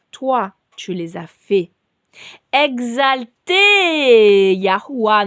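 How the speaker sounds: solemn scripture reading. Acted. Reads a psalm aloud.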